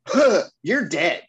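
loud, high gasp, then slow disbelief; jaw-dropped shock. Retold or imitated. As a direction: gasp and act out the stunned officer. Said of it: "his jaw drops".

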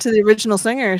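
ordinary talk

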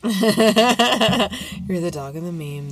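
laughing it out, slow; slow laughing delivery. Real, not acted. Laughing repeat.

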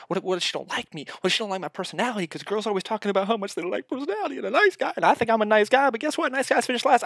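high-pitched, rushed worried rambling; anxious overthinking. Acted. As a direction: spiral into nervous self-talk, pitch climbing. Acts out anxious overthinking before approaching.

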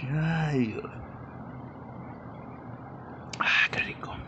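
breathy sigh after a sip of juice; whispered relish after swallowing; while sipping a drink. Real, not acted. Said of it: "le doy un traguito a mi juguito verde".

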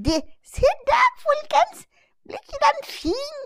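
squeaky little puppet calling to the audience; high puppet voice. Acted. Puppet voice shows off duvet.

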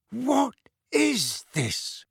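slow, high, hoarse disbelief. A narrator's reading. Uncle stunned by the burning letter, hoarse voice. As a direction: force it out slowly through a hoarse throat. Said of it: "Uncle Vernon said hoarsely".

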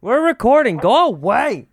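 loud, high, shooing her out; shouting at wife. Real, not acted. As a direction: yell at the intruder to leave. Shooing wife who barged in.

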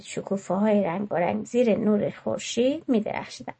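plain reading voice

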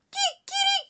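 kikirikí muy agudo de gallo; gallo cantando. A narrator's reading. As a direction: imita el canto del gallo. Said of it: "Yo cacareo así". (el gallo canta kikirikí, muy agudo)

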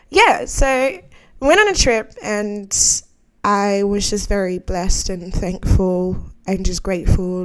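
ordinary talk, nothing marked

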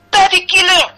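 high, loud accusing scream. Acted. Barmaid accuses the unmasked stranger, screaming. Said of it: "screamed the barmaid".

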